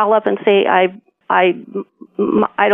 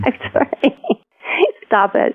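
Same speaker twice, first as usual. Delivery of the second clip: giggling. Real, not acted. Woman on a call telling the caller to stop teasing, giggling. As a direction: try to object while giggling. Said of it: "you're laughing, you're giggling".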